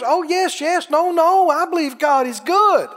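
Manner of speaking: high, mock-pious agreement; mock pious believer. Churchgoer claiming faith, mock pious. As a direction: overdo the polite believer. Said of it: "They'd never say that".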